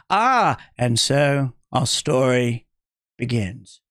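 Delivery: low, slow dramatic narration; storyteller voice. Pretend. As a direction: open like an epic tale. Storyteller opening line.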